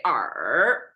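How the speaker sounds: er stretched through a fall and rise; full third tone on er. Shown as a demonstration. Teacher showing the possible alternative, dipping third tone on er. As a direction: sink er low, then bring it up slowly. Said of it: "it's possible too".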